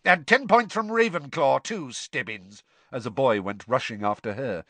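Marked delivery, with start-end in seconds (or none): 0.0-2.6 loud, cutting snarl. Acted. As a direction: bark the penalty. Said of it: "Snape snarled".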